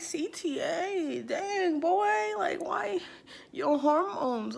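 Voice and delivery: high, loud, exasperated rant; high-pitched exasperation. Retold or imitated. Her retold outburst.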